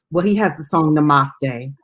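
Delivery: normal talking voice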